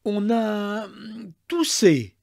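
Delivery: high, drawn-out, halting answer; slow, teasing hesitation; hesitant reveal. A narrator's reading. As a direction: draw it out with a hem before the last word. Husband hesitantly reveals the cough.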